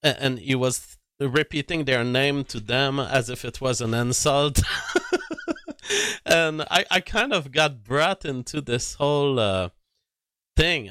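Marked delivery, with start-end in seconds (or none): 4.7-6.2 high, breathy laugh bursting out; real burst of laughter. Real, not acted. Laughing at his own story.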